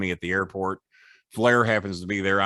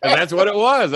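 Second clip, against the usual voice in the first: laughing; 9 semitones higher, 8 dB louder than usual. Real, not acted. Laughing while telling.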